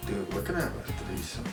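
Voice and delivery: hushed inner thought. Retold or imitated. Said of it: "They're, like, thinking".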